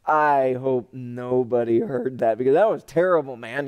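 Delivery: comic sheepish aside; acted embarrassment. Embarrassed aside after fumbled prayer.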